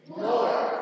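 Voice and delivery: loud rising group shout. Real, not acted. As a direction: yell north with everyone. Shouting direction with the class.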